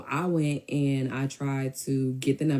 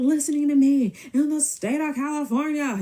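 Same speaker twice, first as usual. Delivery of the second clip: mock indignant yelling; 12 semitones higher, 5 dB louder than usual. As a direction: imitate him ranting, high and stretched out. Mimicking him yelling on phone.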